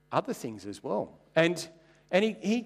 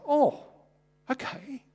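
a mock gasp of surprise; surprised; 12 semitones higher, slower than usual. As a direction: caught off guard, pleasantly. Surprised reaction to major's offer.

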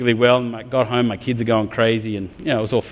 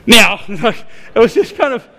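breaking up mid-sentence, higher and louder; laughing through words; 8 semitones higher, 5 dB louder than usual. Real, not acted. Pastor laughing at his own temper, words bubbling with laughter. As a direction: laugh helplessly as you speak.

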